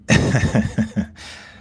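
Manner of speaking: short loud unvoiced laugh; bursting laugh. Real, not acted. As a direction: laugh it off, caught off guard. Embarrassed denial of being naked.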